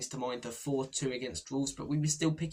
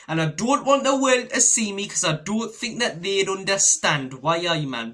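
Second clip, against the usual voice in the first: put-on Geordie accent; 6 semitones higher, 8 dB louder than usual. Quoting the Peter Griffin meme, a loud Geordie accent. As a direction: belt it out Geordie style. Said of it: "saying Iris in a Geordie accent".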